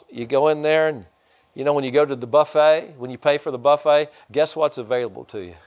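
ordinary talk, nothing marked

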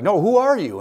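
insistent demand. Retold or imitated. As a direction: demand to know who he really is.

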